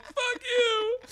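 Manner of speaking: high, tearful imitation wail; mock sobbing. Sobbing skydiver refusing the instructor's offered controls, mock sobbing. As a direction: blubber it in exaggerated tears. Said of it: "I start sobbing uncontrollably".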